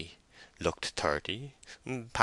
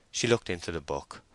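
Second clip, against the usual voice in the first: ordinary delivery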